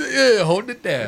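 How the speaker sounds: imitating the voice. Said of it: "What, K-Nuts?".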